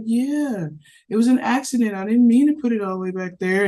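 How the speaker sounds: a hint of a relieved little girl; lightly voiced daughter. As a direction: daughter admitting the accident. Daughter explaining her accident.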